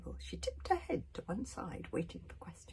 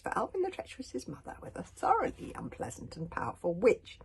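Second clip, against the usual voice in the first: usual speaking voice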